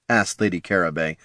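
ordinary delivery

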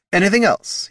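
high, loud, pointed question; sharp don't-you-dare tone; warning question. A narrator's reading. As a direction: ask it daring anyone to answer. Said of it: "tone that suggested there had better not be".

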